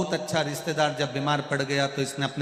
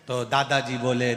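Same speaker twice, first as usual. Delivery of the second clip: ordinary talk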